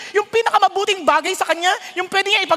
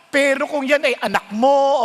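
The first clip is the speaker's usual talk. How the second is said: ordinary delivery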